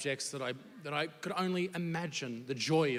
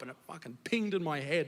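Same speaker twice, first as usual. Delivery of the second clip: normal talking voice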